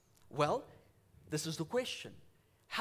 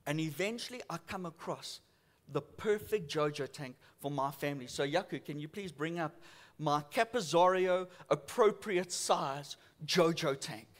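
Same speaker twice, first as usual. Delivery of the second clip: ordinary talk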